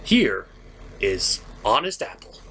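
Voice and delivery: normal talking voice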